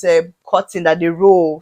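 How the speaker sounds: ordinary talk, nothing marked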